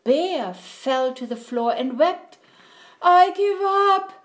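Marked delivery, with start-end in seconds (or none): 3.0-4.1 high, loud wail of defeat; hammed-up tearful surrender; blubbering. A narrator's reading. Bear surrenders to the mouse, blubbering. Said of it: "he blubbered".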